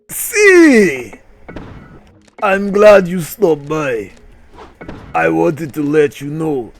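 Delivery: bad Russian accent, exclaiming then slowing; hammed-up Russian block. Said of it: "Said the block".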